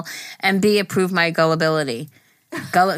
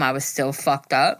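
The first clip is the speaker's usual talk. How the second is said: ordinary talk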